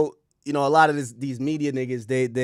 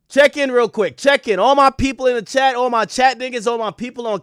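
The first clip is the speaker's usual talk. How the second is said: higher and louder, rallying the chat; hype call-out; 7 semitones higher, 6 dB louder than usual. Real, not acted. Streamer calling his chat to check in, hyped.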